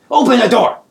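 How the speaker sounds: very high, loud demand; goblin yell. Acted. Goblin pokes hero with chicken wing, loud high yell. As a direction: bellow the order in his face. Krieg pokes player, yells.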